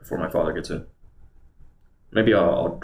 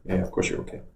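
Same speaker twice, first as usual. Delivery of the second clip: whispered, softer reassurance to the dog; soothing whisper; 5 dB quieter, faster than usual. Real, not acted. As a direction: whisper comfort to the pup.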